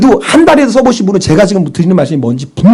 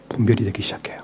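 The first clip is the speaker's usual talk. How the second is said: hushed, whispered revelation; 7 semitones lower, 14 dB quieter than usual. Real, not acted. Preacher confiding to the congregation in a whisper. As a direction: whisper 분별이 like a secret worth leaning in for.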